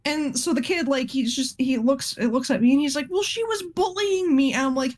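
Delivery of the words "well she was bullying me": put-on whiny kid excuse. Teacher voicing the boy's excuse.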